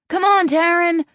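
high, loud cheer from a teammate; teammate's shout. A narrator's reading. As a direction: shout encouragement across the field. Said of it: "one of her teammates shouted".

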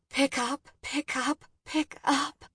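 high, tense muttered plea; anxious, repeated plea; anxious urging. A narrator's reading. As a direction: urge the phone to answer, high and under your breath. Waiting anxiously for 911 to answer.

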